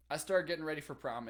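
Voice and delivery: ordinary talk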